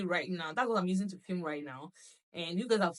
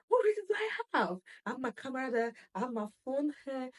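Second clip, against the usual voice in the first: excited; 5 semitones higher than usual. Real, not acted. Gushing rhetorical question.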